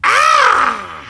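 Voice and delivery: high, full-voiced holler; long open yell; loud scream. Demonstrating how loud he yelled.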